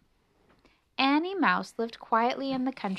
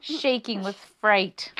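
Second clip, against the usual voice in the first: slow, louder, shuddering narration; shaking with fright; 5 dB louder, slower than usual. A narrator's reading. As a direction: act out the trembling as you tell it.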